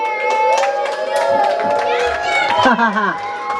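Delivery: shrill teasing cackle; high mocking laugh. Character mocks the mistake.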